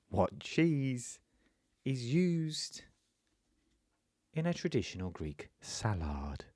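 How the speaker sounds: hushed cookery-show voice-over with dramatic pauses; whispery, suspenseful MasterChef question. Acted. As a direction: tease out each phrase, sinking into a hush. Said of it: "I'll do my MasterChef voice".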